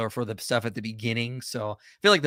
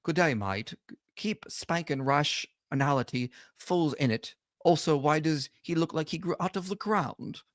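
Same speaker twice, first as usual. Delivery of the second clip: softer, shaky-footed attempt at Australian; attempted Australian accent; 2 semitones higher, 5 dB quieter, slower than usual. Host reading chat as best he can, attempted Australian accent. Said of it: "my brain wants to do an Australian accent".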